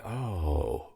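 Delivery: soft, ruminating little oh; hushed, drawn-out realization; quiet. Acted. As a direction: take it in quietly, lost in thought. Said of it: "if I'm a little quiet".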